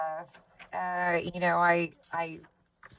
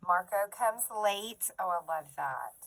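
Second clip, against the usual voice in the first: usual speaking voice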